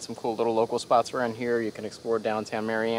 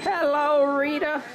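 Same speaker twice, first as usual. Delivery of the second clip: squeaky toy voice; slower than usual. Pretend. Voicing toy greeting.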